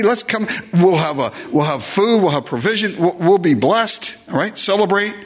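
high, excited call to come home and feast; a lightly voiced, overjoyed Joseph inviting everyone; Joseph's invitation. Joseph urging his brothers home, high and excited. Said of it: "He wants them to just go home".

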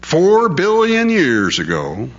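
high, slow, drawn-out recital; mock repeated slogan. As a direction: stretch it out like drummed-in dogma. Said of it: "they say it over and over and over again".

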